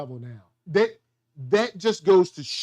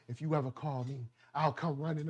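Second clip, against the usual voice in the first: gothic depressed lover; 5 semitones lower, 10 dB quieter than usual. Retold or imitated. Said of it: "in his gothic, depressed way".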